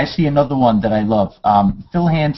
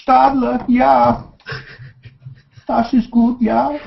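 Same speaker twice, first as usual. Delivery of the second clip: put-on playful German accent, pitched much higher; mock German accent; 10 semitones higher, much slower than usual. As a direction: ham up a German accent for the brand name. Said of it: "Everybody from Germany is now unsubscribing".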